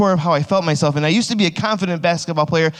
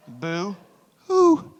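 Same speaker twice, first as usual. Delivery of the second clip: mock-crying "Boo Hoo"; crybaby boo hoo; 4 semitones higher than usual. Shown as a demonstration. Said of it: "isn't that an awesome shirt".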